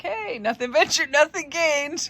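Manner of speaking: loud, high, jokey sign-off; hammed-up cheerful shrug, loud and high; retold breezy bravado. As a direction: toss it out big and playful. Retold cheeky parting line, laughs after.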